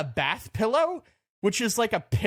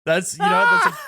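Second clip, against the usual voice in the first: sudden shout; 14 semitones higher, 7 dB louder than usual. Real, not acted. Mock outburst at a good point.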